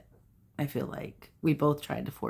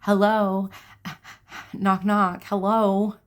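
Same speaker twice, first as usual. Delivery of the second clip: loud, raised-pitch mock yell; playfully exasperated holler, higher and louder; screaming intuition; 5 semitones higher, 6 dB louder, slower than usual. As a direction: call out insistently, like trying to get through. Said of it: "My intuition was screaming at me".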